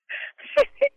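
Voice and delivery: high laugh. Real, not acted. After the sung chant.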